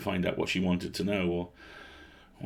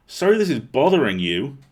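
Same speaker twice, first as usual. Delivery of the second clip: sharp, louder snap at him; a hurt girl snapping back, louder; snapped; 6 semitones higher, 7 dB louder than usual. A narrator's reading. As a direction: bite the words out, stung and irritated. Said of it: "I snapped".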